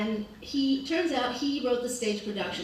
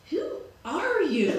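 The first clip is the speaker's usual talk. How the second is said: slow, mocking sneer of a question; imitating a sneer; 6 semitones higher, 3 dB louder, slower than usual. Imitating the dinner guest sneering at the storyteller. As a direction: sneer it down at her slowly. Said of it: "he sneers and says".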